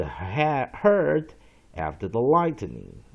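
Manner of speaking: slow, loud, deliberate dictionary reading. Acted. Teacher reading a word's dictionary entry to students, slow read-out. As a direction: read each phrase out slowly and firmly.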